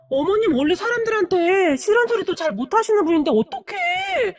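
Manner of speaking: high, wailing disbelief; hammed-up, high-pitched dismay; dismayed astonishment. A narrator's reading. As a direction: fret aloud in shock. Daughter-in-law shocked at mother-in-law's deed.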